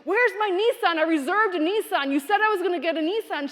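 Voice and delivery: loud, high, fast demanding rant; angry customer screaming. Retold or imitated. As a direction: play an irate customer demanding the car. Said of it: "Like, he screamed at me".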